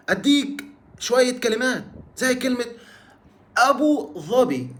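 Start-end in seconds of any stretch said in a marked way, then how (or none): 3.6-4.7 أبو ظبي with ظ said as emphatic th, tongue out; slow, careful standard أبو ظبي, tongue between the teeth; standard Arabic ظ. Shown as a demonstration. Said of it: "دي في الستاندرد". (أبو ظبي بالفصحى، ظاء بإخراج اللسان)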